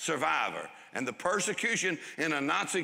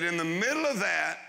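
ordinary talk, nothing marked

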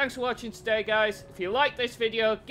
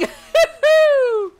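loud, high cackle of glee; high gleeful laugh; 9 dB louder than usual. Real, not acted. Player celebrating a hit, high gleeful laugh.

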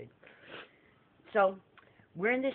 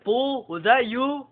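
a louder, slow accusing question; angry demand; 4 semitones higher, 7 dB louder, slower than usual. Retold or imitated. Guest confronting the prankster, loud and slow. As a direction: drag out the accusation. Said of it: "He's like".

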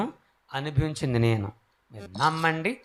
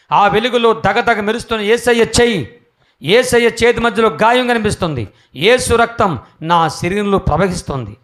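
louder, higher preaching at full force; fervent preaching; 7 semitones higher, 11 dB louder than usual. Acted. Preacher proclaiming Jesus' name to the crowd, loud and fervent. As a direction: proclaim it to the congregation with fire. Climax of healing vision.

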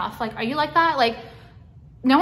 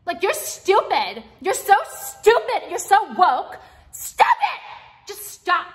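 higher, slow mocking scold that peaks in a squeal; exasperated scolding; 8 semitones higher, 3 dB louder, slower than usual. Vlogger scolds an imagined trendy chef, high mocking exasperation. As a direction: talk down to a chef you think is an idiot.

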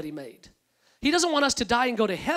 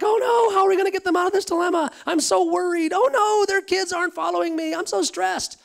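high, fast, panicky mock worry; high, hurried fake panic, played for a laugh; mock fretting God; 9 semitones higher, 5 dB louder than usual. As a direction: ham up a frantic, fretting voice. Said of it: "It's not that I'm super frustrated, like".

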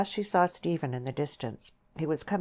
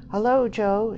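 high, loud hail from friends; shouted greeting; 7 semitones higher, 8 dB louder than usual. A narrator's reading. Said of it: "shouted various friends".

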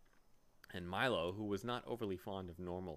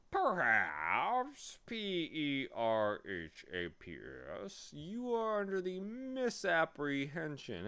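slow, swooping bee voice; spelling bee; 5 semitones higher, 5 dB louder, slower than usual. A narrator's reading. Bee correcting Milo's fear, slow pompous creature voice. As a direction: spell it out with drawn-out, sliding letters.

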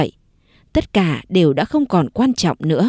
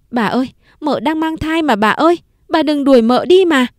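tearful pleading; 6 semitones higher than usual. Acted. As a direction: beg the mistress not to send her away. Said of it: "khóc lóc van xin". (van xin nức nở)

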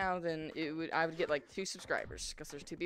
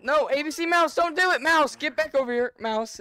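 loud, higher plea to Mouse; calling out; 5 semitones higher, 8 dB louder than usual. Real, not acted. Begging Mouse to come back, in a loud call. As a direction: shout after him.

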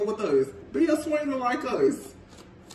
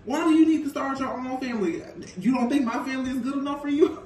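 higher, fast, laughing mock offense; mock indignant man; 4 semitones higher, faster than usual. A boyfriend taking offense at his partner, pitched up, laughing. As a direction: play the wounded boyfriend with a laugh. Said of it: "This man is like".

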